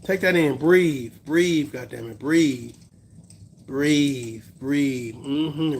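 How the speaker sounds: mock meditation guide; mock calm coach. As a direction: talk someone down like a calm coach, half joking.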